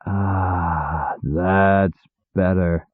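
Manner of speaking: a long relieved sigh after a deep drink; contented sigh. A narrator's reading. Demon sighing after his drink, soft and slow. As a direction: sigh it out slowly and softly. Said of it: "he sighed".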